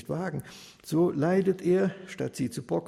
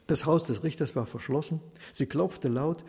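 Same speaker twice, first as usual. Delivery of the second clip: ordinary delivery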